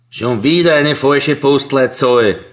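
a loud, slow grumbling scold; scolding loudly. A narrator's reading. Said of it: "schimpft er laut".

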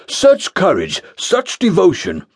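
high, grand false praise; pompous proclamation. Acted. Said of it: "proclaimed Jeremiah Phillips".

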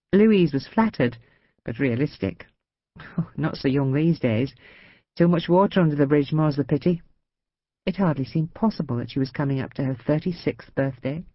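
plain reading voice

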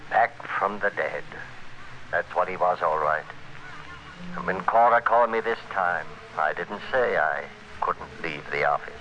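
measured first-person noir narration; hard-boiled radio narrator looking back; story narration. A narrator's reading. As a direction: tell it to the listener like a confession.

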